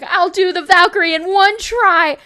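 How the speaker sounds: loud, slow, high and cocky; excited boast. Retold or imitated. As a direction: shout it like a sure thing. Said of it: "I was like".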